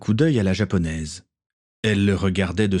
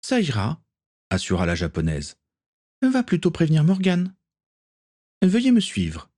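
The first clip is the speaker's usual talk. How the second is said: put-on much higher, calm young woman passing on orders; Akiko's voice; 9 semitones higher than usual. A narrator's reading. Akiko passing Gaël's orders to John, high and light. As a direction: relay the message in a high, light voice.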